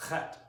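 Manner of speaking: "Chet" with a rasping ch from the throat; throaty ch sound. Shown as a demonstration. Teacher demonstrating the throaty Hebrew sound, rasping. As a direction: scrape "Chet" at the back of the throat like hawking. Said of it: "That'll sound like you're hawking up a loogie".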